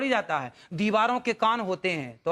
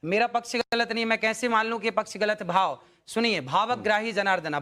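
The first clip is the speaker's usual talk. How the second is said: usual speaking voice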